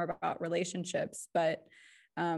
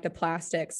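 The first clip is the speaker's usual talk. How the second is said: ordinary talk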